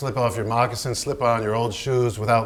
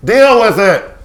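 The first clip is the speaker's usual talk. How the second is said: much higher, louder snap; angry order; 9 semitones higher, 11 dB louder, slower than usual. Acted. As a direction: shout him down. Quarrel on set.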